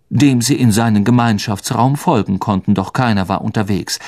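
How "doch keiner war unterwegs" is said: plain reading voice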